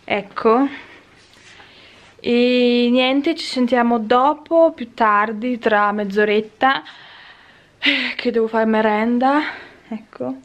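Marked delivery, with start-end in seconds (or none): none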